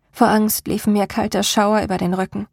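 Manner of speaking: plain reading voice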